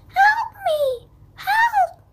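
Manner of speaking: very high panicked cry; screaming for help. Acted. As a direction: scream for help like a terrified girl. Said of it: "and screamed".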